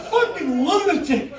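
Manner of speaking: high, loud, worked-up stage delivery; shouted stand-up storytelling. Acted. Comedian telling crowd of wrestling days, shouted and fired up. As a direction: tell it fired up, punching each phrase.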